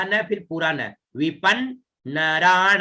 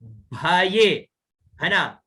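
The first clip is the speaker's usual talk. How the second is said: ordinary talk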